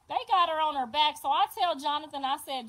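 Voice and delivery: normal talking voice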